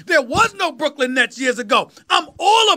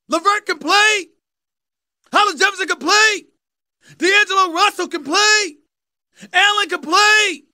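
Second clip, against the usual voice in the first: hyped roll call; 6 semitones higher than usual. Real, not acted. Building hype list of players.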